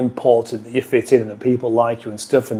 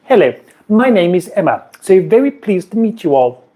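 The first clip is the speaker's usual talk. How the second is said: lightly raised, prim and pleased greeting; a hint of Emma, a touch higher and politer; imitating Emma's voice; 6 semitones higher than usual. Mimicking a viewer named Emma for the chat, lightly raised and prim. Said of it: "And I could do that in her voice".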